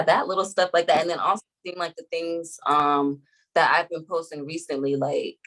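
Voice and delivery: normal talking voice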